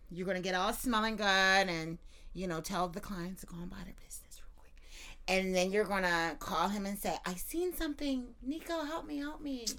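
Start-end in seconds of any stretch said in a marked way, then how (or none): 2.3-7.1 whispered, fast scheming aside; conspiratorial whisper. Real, not acted. 7.2-9.6 higher, softer helpless plea; damsel in distress. Pretend. Acting helpless damsel call.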